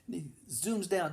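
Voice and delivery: normal talking voice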